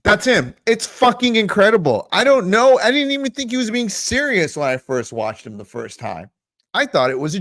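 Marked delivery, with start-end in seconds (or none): 0.0-4.6 loud, high-pitched disbelief; incredulous outburst. Real, not acted. Reacting to his own impression of a rival, incredulous outburst. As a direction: burst out, amazed that it's real.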